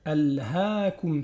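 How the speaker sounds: Al-Hakum with the a held two beats; slow Al-Hakum; two-beat lengthening. Shown as a demonstration. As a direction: stretch the vowel in Al-Hakum. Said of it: "c'est une prolongation".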